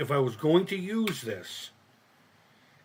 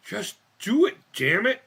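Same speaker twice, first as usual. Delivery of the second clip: forceful; 6 semitones higher, 5 dB louder than usual. Shown as a demonstration. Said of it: "repeated with more force".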